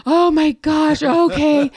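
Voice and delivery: high, loud startled gasp of realization; surprised. Retold or imitated. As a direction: burst out in shocked realization. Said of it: "And she's like".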